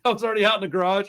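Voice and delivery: usual speaking voice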